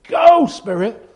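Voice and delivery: loud, high shout of go; Jesus sending the Spirit off with a shout; commanding send-off. Retold or imitated. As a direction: fling the word out like a command. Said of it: "And then he said".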